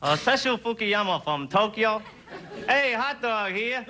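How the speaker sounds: mock Japanese accent, slow call; a Japanese vendor drawing out the hot dog call. Auditioning vendor from Tokyo pitching in a mock Japanese accent. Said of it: "number two".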